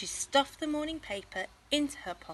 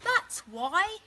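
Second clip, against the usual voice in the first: louder, final put-down; firm mum; 11 semitones higher, 6 dB louder than usual. Acted. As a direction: close the matter firmly. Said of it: "said Missus Large".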